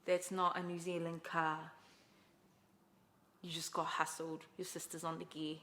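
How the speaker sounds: a hint of the ex scolding; ex's verdict. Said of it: "And he's like".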